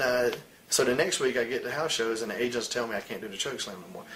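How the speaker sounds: usual speaking voice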